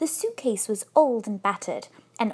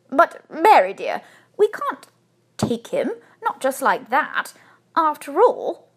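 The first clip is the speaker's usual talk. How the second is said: louder, halting protest; hesitant; 4 semitones higher, 6 dB louder than usual. A narrator's reading. Husband objecting to taking the bear home, hesitant. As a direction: object in broken phrases. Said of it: "Mister Brown hesitated".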